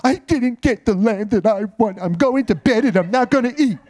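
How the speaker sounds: whiny pouting king voice; sulky king. Retold or imitated. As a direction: sulk like a spoiled king. Ahab sulking, voiced by preacher.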